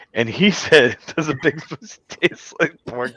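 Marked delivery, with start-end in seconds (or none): 1.2-3.2 higher, slow quote breaking into laughter; lightly imitated dimwit, softer and slow; mock idiot. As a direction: misquote it like a dope, laughing. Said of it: "'Cause he's a fucking idiot".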